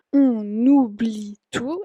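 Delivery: on oublie con la N unida, "o-nubli"; liaison en on oublie. Shown as a demonstration. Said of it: "hay la liaison ahí con la N". (on oublie dicho "onubli", lento)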